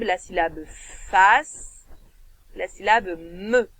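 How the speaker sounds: "fas" then "me", each syllable set apart; teacher breaking a word into syllables. Shown as a demonstration. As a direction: split "phasme" into two syllables clearly. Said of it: "Deux syllabes".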